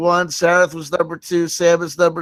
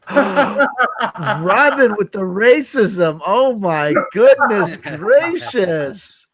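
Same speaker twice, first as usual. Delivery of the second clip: slow, higher gasping mock outrage; mock shock; 4 semitones higher, much slower than usual. Host scolding Robin's joke, slow laughing mock outrage. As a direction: clutch pearls, laughing.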